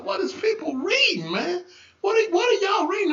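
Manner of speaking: high-pitched, incredulous asking around; baffled questioning. Retold or imitated. Minister asking folk what they are reading, baffled.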